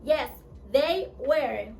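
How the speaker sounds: slow, clear "Yes, they were"; slow demo answer. Shown as a demonstration. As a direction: spell out each word for the class. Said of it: "Y contestamos". (respuesta lenta y clara)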